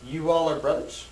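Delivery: brothers? rising at the end; rising inflection. Shown as a demonstration. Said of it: "Turn it into a question with rising inflection".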